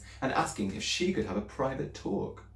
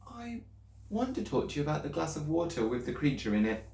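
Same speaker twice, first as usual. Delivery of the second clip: put-on higher, anxious little girl's voice; girl pleading with her teacher, higher and anxious; young Matilda; 6 semitones higher than usual. A narrator's reading. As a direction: speak up as a worried schoolgirl.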